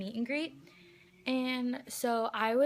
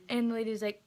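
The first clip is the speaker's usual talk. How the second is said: normal talking voice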